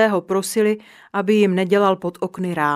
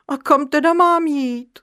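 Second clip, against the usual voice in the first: whiny, higher plaintive question; whining; 7 semitones higher than usual. A narrator's reading. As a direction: whimper it like a scolded little boy. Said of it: "fňukal Neználek".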